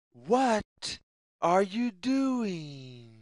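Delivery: plain reading voice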